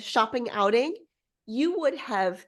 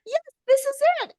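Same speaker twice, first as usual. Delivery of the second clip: much higher, quick thrilled "Yes, this is it"; delighted discovery, pitched way up; excited find; 3 dB louder, faster than usual. Retold or imitated. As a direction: squeal it like you just found the treasure. Friend finds magazine spread.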